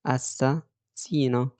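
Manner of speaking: "اساسينو" broken slowly into parts, with a clear S; very slow teaching repeat of "اساسينو", S not Z; hissed double S. Shown as a demonstration. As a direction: draw out "اساسينو" to show the double S. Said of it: "هنطقها اس برضو".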